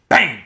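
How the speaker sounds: mock crash sound; crash bang. A narrator's reading. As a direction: bark out the impact. Narrator imitates crash.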